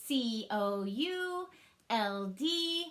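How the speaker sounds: C O U L D spelled out slowly, one letter at a time; slow letter spelling. Shown as a demonstration. Teacher spelling could aloud for the class. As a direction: tap each letter out slowly. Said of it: "One more time".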